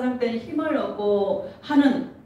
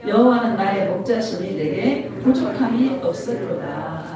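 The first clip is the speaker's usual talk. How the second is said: solemn scripture reading; 3 semitones lower than usual. Acted. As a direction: intone the verse to the flock. Reads Psalm 23 aloud.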